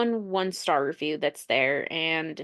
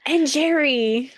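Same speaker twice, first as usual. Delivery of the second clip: playful drawn-out; 5 semitones higher, 5 dB louder, much slower than usual. Pretend.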